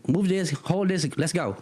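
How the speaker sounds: high, curt orders; lady ordering the kid to carry boxes; brisk boss lady. Retold or imitated. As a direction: bark quick orders. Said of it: "She's like".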